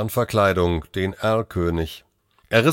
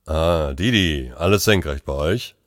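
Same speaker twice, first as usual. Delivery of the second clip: laid-back colleague; 4 semitones lower than usual. Acted. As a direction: answer as if on holiday. Relaxed colleague answers the call.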